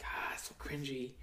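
soft whisper; whispered cringe. Real, not acted. Telling his cohost how awkward it is, in a whisper. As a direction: breathe it out.

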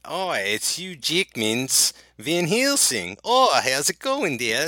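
hammed-up Australian greeting; badly faked, drawn-out Australian accent; terrible Australian accent. Co-host arrives as Hugh Jackman's Van Helsing, bad Australian accent. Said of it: "my terrible Australian accent".

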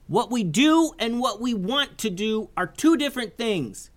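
high youthful voice calling up; yelled advice. Acted. Isaiah calling up to Kavi: high earnest yell. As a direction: shout wise words up to a friend. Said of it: "yelled Isaiah".

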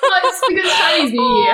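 real laughter mixed with crying; laughing through tears. Real, not acted. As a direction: joke away the tears. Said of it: "I'm emotional".

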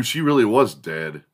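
normal talking voice

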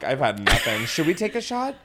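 usual speaking voice

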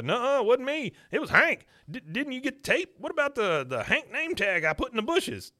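high, loud whiny denial; mock Buck denial. Buck blaming Hank, high and loud whiny denial. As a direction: pin it on Hank in a high whine. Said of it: "Buck's like".

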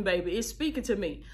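ordinary delivery

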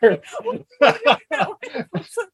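high, loud burst of laughing; loud laughter. Real, not acted. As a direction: crack up loudly.

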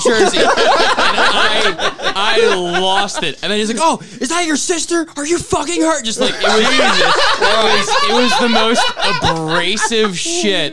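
high, loud, breaking up with laughter; helpless laughter. Real, not acted. Telling hosts the abrasive moment, laughing hard. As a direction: tell it while cracking up.

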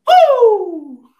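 high, very loud wrestler woo; Ric Flair woo. Retold or imitated. Retelling the stadium chop with a loud Flair woo. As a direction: belt out the wrestler's signature cry. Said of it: "Ric Flair chop".

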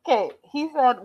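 ordinary talk, nothing marked

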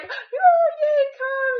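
mock squeal of delight, high and loud; excited welcome. Host mom greeting the new arrival, excited high squeal. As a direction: gush it in a high, giddy voice.

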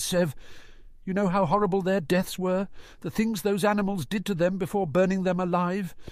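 high, hitching with grief; emotional. Acted. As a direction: struggle through tears. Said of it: "Her voice hitched with emotion".

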